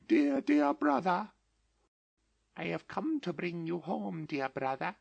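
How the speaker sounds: put-on very high child's voice; a small sister, eager and loving; sweet little sister pleading; little girl voice. A narrator's reading. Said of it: "said the child".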